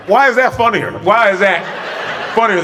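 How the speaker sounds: high laugh spilling into the question; bursting into laughter. Real, not acted. Laughing at her own joke to the viewers, high and giggly. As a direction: crack up and squeak out the line.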